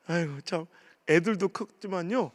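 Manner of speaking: usual speaking voice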